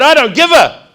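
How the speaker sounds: high, loud furious yell, slow at end; mock furious shout; angry shout. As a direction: yell abuse at the officer. Retold shouting at officer.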